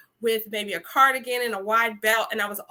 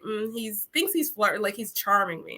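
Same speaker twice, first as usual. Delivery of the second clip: ordinary delivery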